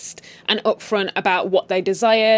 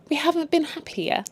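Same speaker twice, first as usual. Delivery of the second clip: higher, softer fake-happy line; fake smile; 8 semitones higher, 4 dB quieter than usual. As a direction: say it through a forced smile. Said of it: "Fake smiles".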